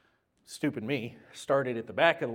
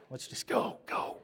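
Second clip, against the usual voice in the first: 1 whispered aside; 3 dB quieter than usual. Retold or imitated. Driver urges leaving after prayer.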